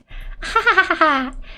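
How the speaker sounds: high carefree giggle; sweet innocent laugh. Acted. Inotsuba unconcerned about the burning car, innocent laugh. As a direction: laugh sweetly, without a care. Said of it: "Her sweet, innocent laugh".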